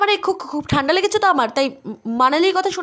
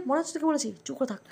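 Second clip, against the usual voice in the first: much softer warning under the breath; sister's hushed threat to her brother; hushed aside; 6 semitones lower, 11 dB quieter than usual. Acted. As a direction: warn him quietly, lower.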